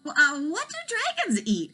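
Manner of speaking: a hint of a wondering boy; curious boy. Elmer asks the dragon, lightly boyish.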